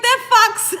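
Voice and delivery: high loud threat broken by laughter; mock furious threat. Husband vowing to kill the fox, loud laughing threat. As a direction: laugh through a hammed-up threat. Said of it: "My husband was mad".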